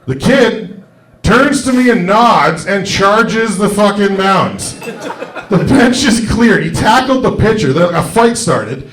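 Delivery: rising, laughing excitement telling the fight; excited build. Real, not acted. Comic telling the crowd how the fight broke out, excited. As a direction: let it build up high and bright with glee. Climax of the drunk story.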